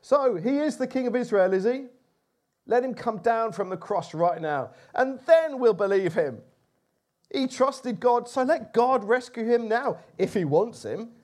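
religious leaders gloating at the crucified man; scoffing mockery. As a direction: jeer like priests enjoying his fall. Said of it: "they scoffed".

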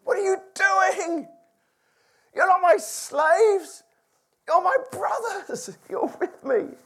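half-laughing, half-weeping Joseph scolding his brothers; a touch of tearful affection, high and loud; half crying Joseph. Retold or imitated. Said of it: "Joseph was half crying, half just going".

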